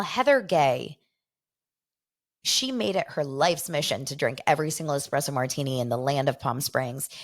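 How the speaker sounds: normal talking voice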